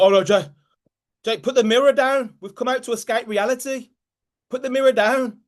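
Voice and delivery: high-pitched mock objection from uncomfortable friends; acted-out high protest; pleading protest. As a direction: plead with him to stop, pitched well up.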